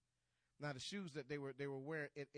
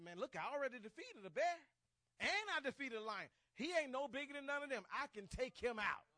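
high, loud preacher voicing young David's boast; bold boasting; 9 semitones higher, 7 dB louder than usual. David to doubters about the bear and lion, bold boast. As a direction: proclaim your victories with full confidence.

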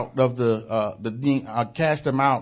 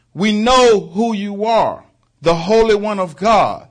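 high, loud mock demon cry; demons screaming; 8 semitones higher, 7 dB louder than usual. Preacher voicing demons crying out to Christ, loud. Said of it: "they screamed and hollered".

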